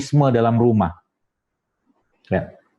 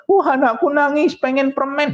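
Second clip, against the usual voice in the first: put-on higher, fussing over the crying child; mock doting parent; 11 semitones higher than usual. Parent worrying over a crying child wanting candy, mock doting.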